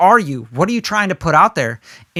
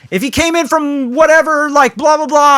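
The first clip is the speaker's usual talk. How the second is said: much higher, louder hype banter; hyped stage hook; 10 semitones higher, 4 dB louder than usual. Retold or imitated. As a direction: sell the hook like an MC. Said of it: "I say some sort of hook".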